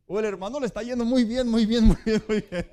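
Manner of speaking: a gleeful laughing shout; laughing, going well. Shown as a demonstration. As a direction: burst out joyfully at "muy bien". Contrasting two joyful brothers.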